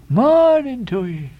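high, slow, ringing "Morning to ye"; Elijah's farewell. A narrator's reading. Elijah calling goodbye to the sailors, high and slow. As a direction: sing out the parting in full voice. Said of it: "he rejoined again, moving off".

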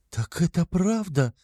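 high, slow, disbelieving question; aghast mother, pitch soaring; shocked cry. A narrator's reading. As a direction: cry out in dismay without volume. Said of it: "вскричала Пульхерия Александровна".

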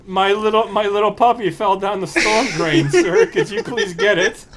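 put-on high, pleading little kid; innocent kid voice. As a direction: lure him in sweetly. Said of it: "I'd be like".

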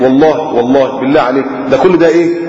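ordinary talk

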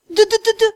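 high muffled da-da through a gag; muffled mumbling. Acted. Gagged heroine mumbling through gag.

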